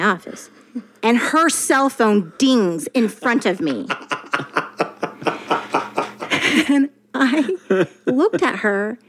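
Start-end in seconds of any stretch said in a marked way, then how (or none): 3.9-6.6 embarrassed laugh. Real, not acted. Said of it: "Oh, shit".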